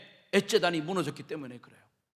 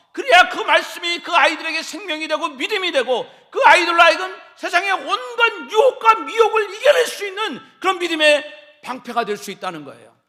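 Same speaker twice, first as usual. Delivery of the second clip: loud, high fervent preaching; full force preaching; 7 semitones higher, 12 dB louder than usual. Acted. Pastor urging parents loudly in fervent preaching. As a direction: drive it home to the congregation, loud.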